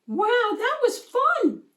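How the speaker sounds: high, slow breathless delight. A narrator's reading. Squid classmate after playing, breathless with joy. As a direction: gasp it out after hard play. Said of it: "said the new kid breathlessly".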